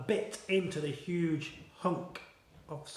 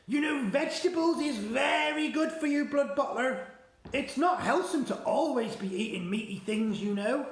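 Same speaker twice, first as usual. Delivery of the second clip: higher, louder hearty giant; BFG voice; 7 semitones higher, 3 dB louder than usual. Acted. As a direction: cheerfully preach vegetables. BFG lectures about vegetables.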